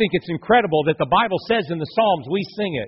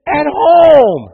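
high, loud exasperated outburst; exasperated parent; 7 semitones higher, 9 dB louder, slower than usual. Acted. Parent scolding kids for behaving better elsewhere, exasperated. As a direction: vent at the kids, pitched up and loud. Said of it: "And you thought".

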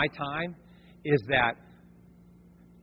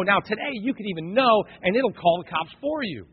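normal talking voice

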